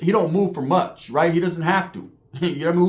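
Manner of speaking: usual speaking voice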